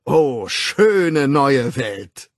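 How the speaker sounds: loud, slowly drawn-out rallying call; battle cry. A narrator's reading. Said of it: "bliesen sie nun plötzlich zum Angriff". (laut zum Angriff rufend)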